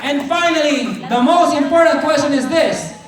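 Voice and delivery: loud, high rallying preacher voice; a big motivational shout from the stage; preaching at full force. Acted. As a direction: fire up the crowd like a revival preacher. Motivational speaker rallies the audience.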